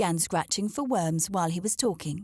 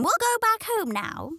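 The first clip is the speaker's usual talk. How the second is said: high, brisk mother hen; 4 dB louder than usual. Acted. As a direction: call the chicks home, high-voiced.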